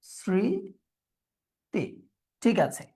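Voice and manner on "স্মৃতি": "স্মৃতি" drawn out as "sri... ti", very slow; syllable-by-syllable pronunciation. Shown as a demonstration. Teacher writing it out, স্মৃতি dictated slowly by syllable. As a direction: stretch "স্মৃতি" into two syllables with a long gap. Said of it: "তাহলে আমরা লিখি".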